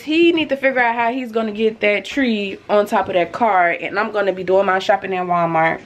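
usual speaking voice

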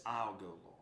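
very low, soft surrender; humble acceptance. Acted. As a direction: answer God meekly, barely above a murmur. Voicing Isaiah's humble answer.

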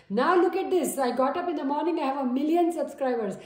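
high, loud, thrilled disbelief; excited shout at a million subscribers; amazed delight. Retold or imitated. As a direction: burst out at waking up famous. Acted viewer amazed at growth.

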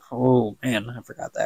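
ordinary delivery

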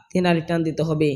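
ordinary talk

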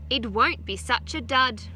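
usual speaking voice

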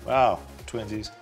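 ordinary delivery

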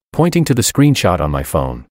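ordinary delivery

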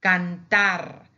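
cantar loud, final r trilled; double R at end. Shown as a demonstration. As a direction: punch out cantar and roll the last r. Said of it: "we pronounce it as double".

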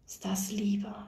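soft voiceless question to herself; wondering whisper. A narrator's reading. Nurse asking herself about love, whispered.

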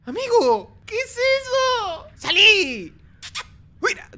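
high, drawn-out frightened wail; panicked scream. Real, not acted. Frightened by horror game. (grito de pánico)

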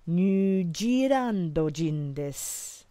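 "New Zealand" said Nyu Jiilando, very slowly; drawn out with a J sound in place of the Z; slow Japanese pronunciation. Shown as a demonstration. Teacher showing the Japanese way to say New Zealand, very slow. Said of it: "Substituting the Z for a G sound".

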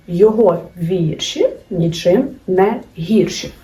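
slow, вірші stressed on the first syllable "ві" to rhyme with гірші; slow mnemonic rhyme. Shown as a demonstration. Teacher to students, slowly reciting the вірші rhyme. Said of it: "Запам'ятовуємо".

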